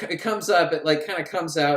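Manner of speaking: usual speaking voice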